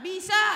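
high, loud, instant "Bisa"; punchy can-do reply on "Bisa"; confident answer. Acted. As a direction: fire back "Bisa" with certainty. Acted confident answer to challenge.

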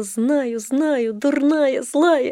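remorseful cry. A narrator's reading. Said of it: "крикнула". (Соня кается брату: громкий выкрик)

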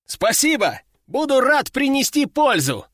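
high, eager, happy voice; joyful. A narrator's reading. Recruit accepting warehouse duty, joyful reply. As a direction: answer with eager delight. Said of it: "радостно отозвался я".

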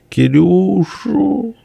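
very high, long-drawn wail; fighting back sobs. A narrator's reading. Peasant woman naming who was taken, in a tearful wail. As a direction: wail the name out slowly. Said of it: "борясь с рыданиями".

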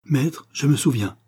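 animated servant's exclamation; lively, eager. A narrator's reading. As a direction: blurt it out eagerly to the master. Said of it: "dit-il vivement".

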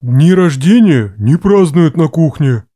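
low, loud, slow male voice; stubborn low objection. A narrator's reading. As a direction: insist firmly in a deep voice. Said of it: "настырно возразил Гран". (упрямое возражение низким голосом)

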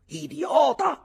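very high, loud screamed insult; furious yell. A narrator's reading. Ulick yells while beating the young narrator, furious shout.

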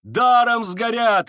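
loud, high, drawn-out roar; crowd roaring. A narrator's reading. Voices roar from all sides at the burning, roaring. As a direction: bellow it slowly, pitched high. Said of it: "ревели со всех сторон".

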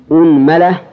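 أنملة said "unmala", u then a; u-a vowels. Shown as a demonstration. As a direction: open on u, then "mala". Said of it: "ضم الهمزة".

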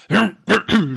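mock throat clearing after a cigarette; hammed-up harrumph; imitated throat clearing. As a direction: clear your throat as if smoke-irritated. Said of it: "so ging das dann die ganze Zeit".

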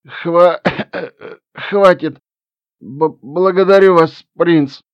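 put-on old gnome squeezing words out; strained old gnome. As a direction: choke the words out like a frail old man. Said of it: "выдавил дед". (выдавленные слова старого гнома)

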